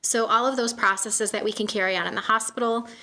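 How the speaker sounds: usual speaking voice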